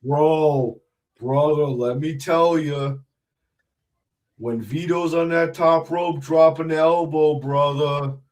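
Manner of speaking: slow, drawn-out wrestler promo voice; Big Vito impression. Guest shows the host his Big Vito, slow drawn-out impression. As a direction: stretch every word, put-on tough-guy wrestler. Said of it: "I can do a Big Vito impression".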